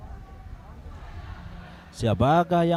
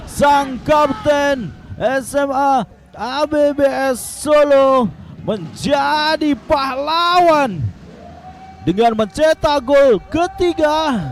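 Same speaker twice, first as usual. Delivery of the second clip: loud, high, drawn-out celebration; excited shout; 7 semitones higher, 4 dB louder, slower than usual. Real, not acted. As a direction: hail the scorer at full voice. Celebrating the goal scorer.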